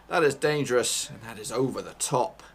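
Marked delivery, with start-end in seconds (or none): 0.1-1.1 louder alarmed warning; raised urgent warning; alarmed shout. Real, not acted. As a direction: call out the danger loudly. Repeat much louder.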